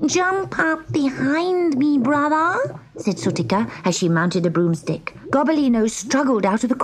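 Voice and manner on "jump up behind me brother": high, bright kitten voice; little cat sister. A narrator's reading. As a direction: urge him onto the broom. Sutika calls to brother.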